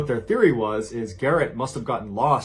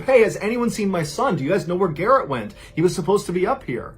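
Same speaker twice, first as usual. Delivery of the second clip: raised, higher-pitched worried call; panicked calling out; 6 semitones higher, 4 dB louder than usual. A narrator's reading. Worried father asks the campers about his son, calling out in panic. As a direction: call out to a group with mild panic. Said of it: "starting to panic and he yells out to the group".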